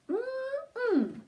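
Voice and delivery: high, savoring mm-mm; high delighted yum; yummy mm-mm. Acted. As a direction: relish a tasty treat. Yummy sound after food list.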